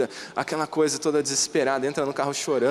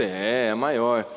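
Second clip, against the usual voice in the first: slow emphatic agreement; 4 semitones lower, much slower than usual. Retold or imitated. Father answering son, slow.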